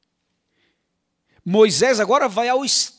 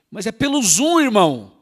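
louder, higher push-back; loud exasperated retort; 4 semitones higher, 7 dB louder than usual. Acted. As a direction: call out the excuse, exasperated. Preacher rebukes timid excuse-maker.

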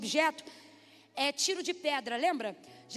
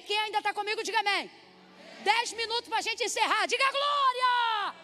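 preaching call-out; 6 semitones higher than usual. Real, not acted. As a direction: whip the congregation up, stretching each call. Preacher rallies congregation. (convocação pregada, alta e arrastada)

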